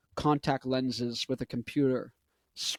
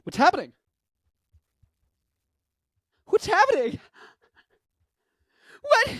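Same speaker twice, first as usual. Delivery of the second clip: put-on much higher, louder dazed old lady; confused grandma impression; 4 dB louder than usual. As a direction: play grandma lost and bewildered. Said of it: "That's my impression of grandma".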